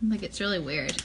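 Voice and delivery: usual speaking voice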